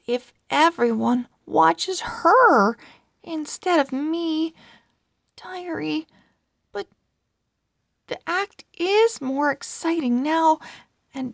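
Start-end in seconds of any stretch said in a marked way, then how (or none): none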